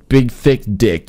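loud punchline yell; shouting. Real, not acted. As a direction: belt out the punchline.

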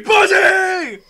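very high, sustained yell; imitated scream. Counselor screaming at kids in the pool, imitated scream. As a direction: scream the roll call at full volume. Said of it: "they go screaming".

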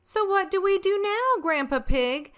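put-on high small-child question; little rabbit voice. A narrator's reading. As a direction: ask it like a tiny curious rabbit. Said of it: "asks Rebecca Rabbit".

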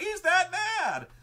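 very high, loud, drawn-out retort; slow mock yell, driving the point home; emphatic confirmation. Game master confirming Tempest is that mad, emphatic yell. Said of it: "And he's like".

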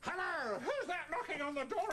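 high puppet voice; pig character. Acted. Puppeteer as the pig answering the door, high-voiced.

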